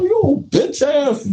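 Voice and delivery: slow, high taunt; high drawn-out taunt. Retold or imitated. Calling him out before slap.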